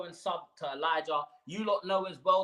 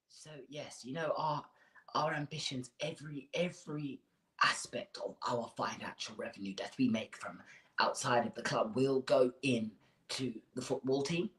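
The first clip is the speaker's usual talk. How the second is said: a hint of a soft, low chairman voice; mock Levy, quiet and smooth; lightly voiced Daniel Levy; 4 semitones lower, 6 dB quieter than usual. A fan mimics chairman Levy at the fan forum, low and soft. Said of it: "let me do my Daniel Levy impression".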